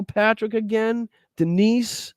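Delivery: high-pitched, drawn-out donor names; names sung out high in disbelief; amazed roll call. Real, not acted. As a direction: call each name high, amazed at the pile-up. More donor names keep coming.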